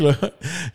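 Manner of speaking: carcajada suelta; risa real. Real, not acted. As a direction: se ríe sin poder parar. Laughing fit. (ataque de risa)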